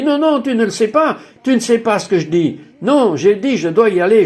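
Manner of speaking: insistent protest. Retold or imitated. Speaker argues with the Lord to be sent back, insistent protest. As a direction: push back insistently, starting high.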